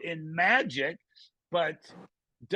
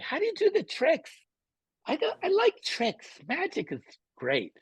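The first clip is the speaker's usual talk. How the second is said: much higher mock voice of a puzzled fan; Jim Gaffigan inner voice; 11 semitones higher than usual. Comic imitating Gaffigan's inner-voice bit for the host, high mock voice. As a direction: pitch it way up like an imagined audience member. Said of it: "Who am I doing?".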